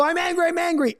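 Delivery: high-pitched mock outburst of I'm angry; mock angry dad. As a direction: squeak out angry like a cartoon dad blowing up. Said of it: "when you get angry and blow up and you go".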